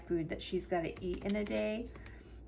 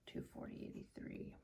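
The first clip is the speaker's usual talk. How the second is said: whispering; 16 dB quieter, faster than usual. Real, not acted. Whispering while entering amounts.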